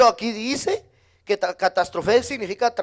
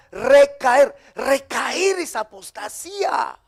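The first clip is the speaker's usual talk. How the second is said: slow emphasis; 3 semitones higher, much slower than usual. Real, not acted. Preacher defining apostasy to the church, slow and weighty. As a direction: lean slowly on "recaer".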